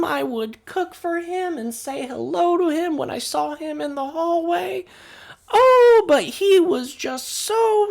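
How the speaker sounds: put-on very high, loud, quavering old lady voice; fussy old neighbour. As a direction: gush over the dead man like a busybody granny.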